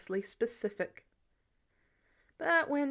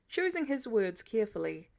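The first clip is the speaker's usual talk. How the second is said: ordinary delivery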